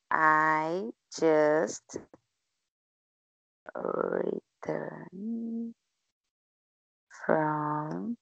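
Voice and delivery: slow word by word. Shown as a demonstration. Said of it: "Pode continuar fazendo assim".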